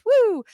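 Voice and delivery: high, loud celebratory woo; cheerful whoop. Real, not acted. Host whooping while thanking the patrons. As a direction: let out a happy whoop.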